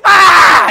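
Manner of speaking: put-on sudden shout of a startled kid; mock yell. Kids all yelling out at once.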